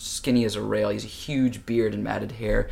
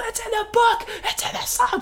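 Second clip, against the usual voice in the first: very high, loud raving; frantic yelling; 5 dB louder than usual. Retold or imitated. Dad shaking people at the concert, frantic yelling. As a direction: yell it at everyone you grab.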